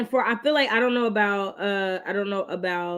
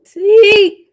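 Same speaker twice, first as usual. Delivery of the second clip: startled; 10 semitones higher, 14 dB louder than usual. Real, not acted. Reacting to stream glitch.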